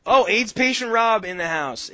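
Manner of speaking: loud, high hype welcome; excited loud greeting. Real, not acted. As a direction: shout out the arrival. Greeting arriving caller loudly.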